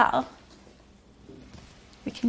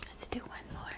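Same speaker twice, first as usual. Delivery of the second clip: quick hushed aside mid-song; soft whisper to the class; 23 dB quieter, much faster than usual. Real, not acted. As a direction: drop to a whisper between verses. Whispered aside between verses.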